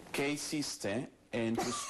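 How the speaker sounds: very slow, every word spelled out; slow, careful Spanish for a learner. Shown as a demonstration. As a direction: speak slowly to a beginner. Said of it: "I'm not talking to you like a baby".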